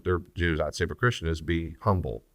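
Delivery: ordinary talk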